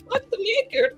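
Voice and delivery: usual speaking voice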